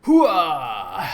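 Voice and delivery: full-throated soldier battle cry; loud gung-ho soldier. Real, not acted. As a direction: roar it like a charging marine. Fighting enemies, battle cry.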